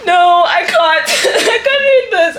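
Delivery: high wail dissolving into laughter; tearful laughing protest. Real, not acted. Woman unable to read on, high laugh-crying. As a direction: give up, voice climbing through giggly tears.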